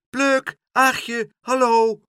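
put-on high, loud children's call; eager little voices hailing their friends. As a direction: call across the village street. Children calling from the street.

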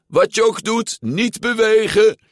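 high, loud yell; yelled warning. A narrator's reading. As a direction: yell an urgent order from a distance. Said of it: "sprong op de Vespa en gilde". (gillend bevel)

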